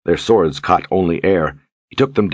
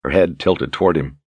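usual speaking voice

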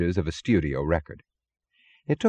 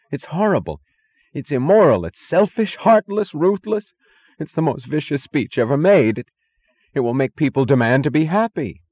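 high, loud hysterical outburst; helpless rage; 8 semitones higher, 5 dB louder than usual. A narrator's reading. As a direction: pile up accusations in a near-sobbing wail. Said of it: "an adult sobbing with helpless rage".

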